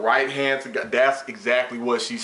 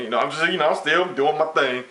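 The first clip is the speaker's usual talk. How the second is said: normal talking voice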